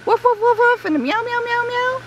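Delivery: high quick woofs turning into meows; high-pitched animal noises in a row; doggy and kitty mix. Shown as a demonstration. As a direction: imitate a little dog, then a cat. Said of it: "a doggy and a kitty mixed together".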